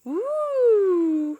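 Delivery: rising ooh of pleasure; a high happy ooh; high delighted ooh. Acted. Teacher to kids, caterpillar tasting food, high delighted ooh.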